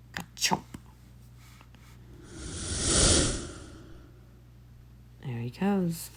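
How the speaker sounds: quiet, hushed aside; hushed voice tracking the chase; hushed commentary. Real, not acted. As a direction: murmur as if not to disturb. Quiet commentary over the video.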